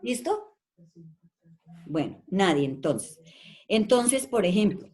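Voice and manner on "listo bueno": usual speaking voice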